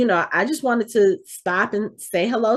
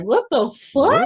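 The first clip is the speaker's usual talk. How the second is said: slow, raised, incredulous what-the; mock a stunned teen staring at mom; drawn-out incredulous swear; baffled disbelief; 7 semitones higher, 3 dB louder, slower than usual. Daughter's baffled look voiced.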